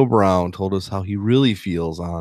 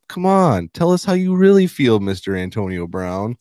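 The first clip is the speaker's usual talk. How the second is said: mock audience reaction; 2 semitones higher than usual. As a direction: egg him on in a raised voice. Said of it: "you're gonna be like".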